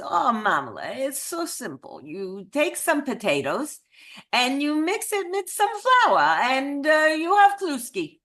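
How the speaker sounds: lilting, comically bad Eastern European accent, climbing high; Muppet-chef take on an Eastern European accent. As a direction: explain it breezily in a terrible Eastern European accent. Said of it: "I do the absolute worst Eastern European accent".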